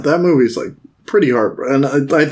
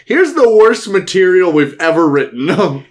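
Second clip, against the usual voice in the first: higher, slow mock announcement; mock proud presenter; 5 semitones higher, 3 dB louder than usual. Filmmakers handing great actors a bad script, mock-proud. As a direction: present it with hammed-up fanfare.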